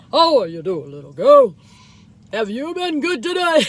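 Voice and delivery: put-on loud jolly Santa asking a child; booming kindly Santa. Shown as a demonstration. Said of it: "I sit back here like this".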